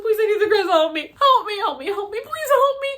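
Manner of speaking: high, loud retold plea for help; a retold, hammed-up begging for help; desperate begging.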